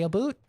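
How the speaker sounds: about with "oo", rising as "a boot"; mock Canadian "a boot" for about. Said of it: "that's why I don't say".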